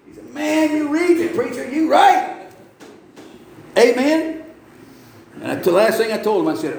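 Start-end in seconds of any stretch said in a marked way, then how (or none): 0.3-2.5 high, excited retold reply; imitating a thrilled listener; amazed exclamation. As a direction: exclaim it high, as an astonished convert. Acts out trucker's amazed reply.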